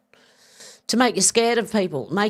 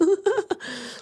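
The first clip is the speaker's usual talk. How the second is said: short, high burst of laughter; 13 semitones higher than usual. Real, not acted. Laughing at Alex's chat joke, high burst.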